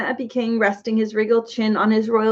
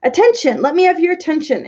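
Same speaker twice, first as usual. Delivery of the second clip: louder, higher king's insistent call; 8 semitones higher, 4 dB louder than usual. A narrator's reading. King pounding the table, calling loudly for attention. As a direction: demand the hall's attention. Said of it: "insisted the king".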